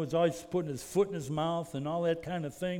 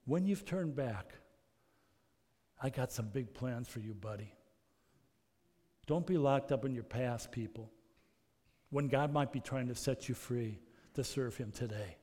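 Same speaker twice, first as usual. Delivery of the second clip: much softer, low and reassuring; Jesus quietly comforting Peter, much softer; tender Jesus; 5 semitones lower, 5 dB quieter than usual. Acted. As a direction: hush down like Jesus comforting a friend.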